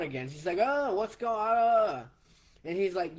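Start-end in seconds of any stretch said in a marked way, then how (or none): 0.6-2.1 high, loud, slowed alarmed cry; mother startled by the scene, higher and louder. Retold or imitated. Mother seeing her son and the towers, crying out in alarm.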